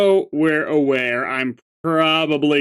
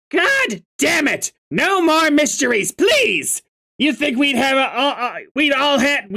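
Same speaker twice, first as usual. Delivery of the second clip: Karkat exasperated; 10 semitones higher than usual. Acted. Karkat fed up with riddles, shouting high. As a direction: explode upward, sick of mysteries.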